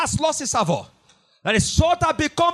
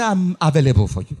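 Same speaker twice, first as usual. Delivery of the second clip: mock seductive offer; 10 semitones lower, faster than usual. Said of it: "advertising their breast and say".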